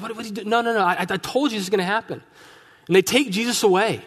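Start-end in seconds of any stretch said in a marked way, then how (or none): 0.0-2.2 higher, exasperated what-are-you-doing; exasperated rebuke. Retold or imitated. Jesus scolding Peter after he cuts off an ear, exasperated. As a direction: throw up your hands at a reckless friend.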